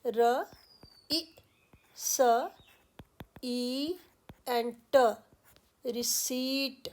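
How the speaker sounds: रिसीट broken into र इ स ई ट, then drawn out slowly with no प; spelled out slowly. Shown as a demonstration. Teacher spelling out रिसीट for students. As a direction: sound by sound, then a slow रिसीट. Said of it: "प is silent".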